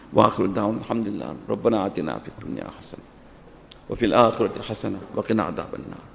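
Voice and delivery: soft, low closing dua; quiet prayer. Real, not acted. Imam closing the nikah sermon with a soft prayer.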